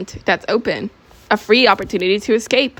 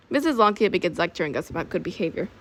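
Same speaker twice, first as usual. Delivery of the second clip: ordinary delivery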